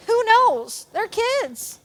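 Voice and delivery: high, careless mock brush-off; mock shrug. Pastor mocks a volunteer who shrugs off the kids. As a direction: toss it off like it doesn't matter.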